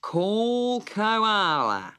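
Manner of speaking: very slow, pompous bird voice; solemn pelican. A narrator's reading. Pelican calls the next witness, slow and solemn. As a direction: drag out the order. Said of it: "said the pelican".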